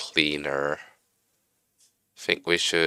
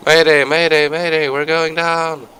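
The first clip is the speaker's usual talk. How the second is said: high, loud mock pilot distress call; mayday radio call; 10 semitones higher, 5 dB louder than usual. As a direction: play the panicking pilot radioing in. Plane flying upside down.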